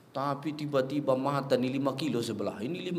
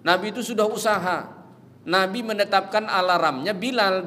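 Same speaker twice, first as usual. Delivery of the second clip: ordinary delivery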